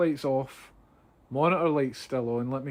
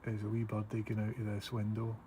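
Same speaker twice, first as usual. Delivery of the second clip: hushed, softer narration; quiet; 3 semitones lower, 8 dB quieter than usual. Real, not acted. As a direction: keep it low and hushed. Waiting quietly in dark.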